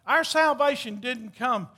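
ordinary talk, nothing marked

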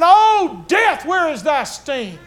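high shouted, slowing on sting; triumphant cry. A narrator's reading. The rising dead cry out in victory, slow shout. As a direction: cry it out in victory over death. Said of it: "they'll go up singing".

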